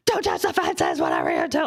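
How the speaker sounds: put-on high, sharp warning to the kids; warning yell. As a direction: shout it at children near a fence. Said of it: "I'm like".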